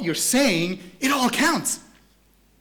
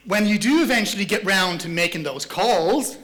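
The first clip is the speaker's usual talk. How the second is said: ordinary talk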